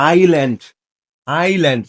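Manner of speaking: "আইল্যান্ড", silent S, said on a low falling tone; correct pronunciation. Shown as a demonstration. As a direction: model "আইল্যান্ড" as eye-land, falling. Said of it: "এটা হলো".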